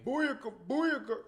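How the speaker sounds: hyped Jamaican dancehall shout; Jamaican accent. Pretend. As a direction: Jamaican accent, loud and high. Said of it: "He got his Jamaican accent going".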